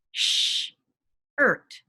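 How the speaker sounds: Sh-ert said in two parts, sh held then ert; teacher giving two parts of shirt; onset and rime split. Shown as a demonstration. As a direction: separate the sh from the ert. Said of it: "I'm gonna give you two parts of a word".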